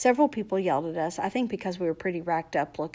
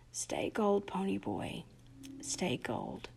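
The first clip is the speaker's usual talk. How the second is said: a soft, dying whisper; barely heard whisper; 11 dB quieter, slower than usual. A narrator's reading. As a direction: breathe it out as the last words. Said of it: "I barely heard him".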